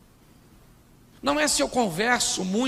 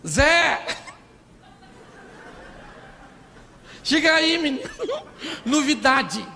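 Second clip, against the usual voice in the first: calling out; 6 semitones higher than usual. Acted. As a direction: play Maria calling her man over with news. Acted Maria calling Zé over.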